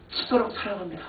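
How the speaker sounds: quiet earnest praise. Shown as a demonstration. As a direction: say it gently, meaning every word. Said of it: "미치겠다 생각하면".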